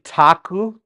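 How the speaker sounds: "taku" with a crisp English T; hard T. Shown as a demonstration. Teacher showing what we're not saying, "taku" with hard T. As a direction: hit the T of "taku" hard, as the mistake. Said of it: "You notice we're not saying".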